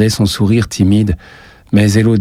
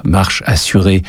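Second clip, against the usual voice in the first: ordinary delivery, nothing marked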